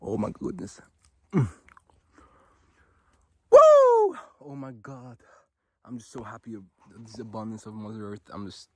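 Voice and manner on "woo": high loud whoop of joy; joyful whoop. Real, not acted. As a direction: let out a happy woo.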